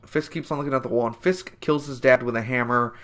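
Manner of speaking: normal talking voice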